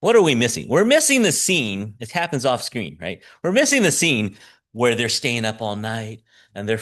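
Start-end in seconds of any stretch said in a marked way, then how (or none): none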